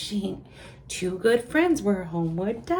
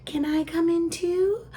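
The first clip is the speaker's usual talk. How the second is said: higher, pleading cow voice; 9 semitones higher, 3 dB louder than usual. A narrator's reading. The cow asks to come in, higher pleading voice. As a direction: ask sweetly to come in. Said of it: "said the cow".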